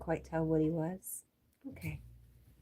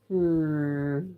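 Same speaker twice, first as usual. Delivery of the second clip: normal talking voice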